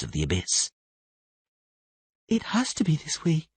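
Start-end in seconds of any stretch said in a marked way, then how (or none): 2.3-3.5 put-on high whispered, trembling girl; shaky whisper. A narrator's reading. Siri whispering fearfully to Tris at the stairs. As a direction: whisper it as if scared. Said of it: "Siri said in a shaky voice".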